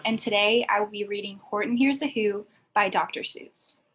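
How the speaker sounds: ordinary delivery, nothing marked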